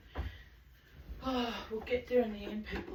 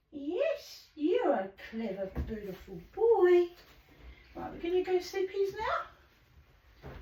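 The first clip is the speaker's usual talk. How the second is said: cooing pet talk; 9 semitones higher than usual. Real, not acted. Cooing at the bird.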